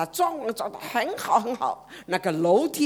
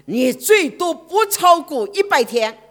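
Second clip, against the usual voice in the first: louder, higher and slow, a grave sentence; stern doctor's verdict; 7 semitones higher, 7 dB louder than usual. Retold or imitated. Doctor telling the young man his time is short, stern and loud. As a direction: hammer out the deadline like a stern doctor.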